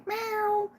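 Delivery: high squeaky imitated meow; cat meow. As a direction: mimic the cat's little cry. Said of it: "She's like".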